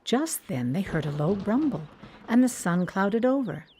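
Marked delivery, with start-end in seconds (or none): none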